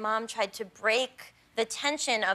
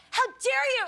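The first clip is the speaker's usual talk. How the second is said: high indignant how dare you; high, loud mock indignation; mock outrage; 13 semitones higher, 3 dB louder than usual. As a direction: play the outraged hero. Confronting the biker, retold.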